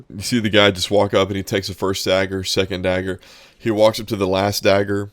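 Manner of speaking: ordinary talk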